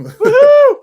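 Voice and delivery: loud, high celebratory woohoo; excited whoop. Real, not acted. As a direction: whoop like you're at a party. Cheering back at friend.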